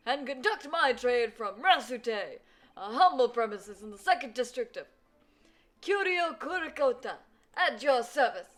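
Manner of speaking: put-on loud, high rambling old gentleman; old man voice. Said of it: "I only have one old man voice".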